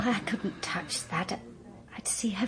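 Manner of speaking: slow, hushed whisper, haunted; whispered refusal. Acted. Joyce refusing the dead woman's money, in a slow whisper. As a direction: whisper it as if you can't bear it.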